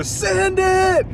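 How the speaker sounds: echoing crowd chant; mock crowd shout. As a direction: imitate the whole crowd yelling.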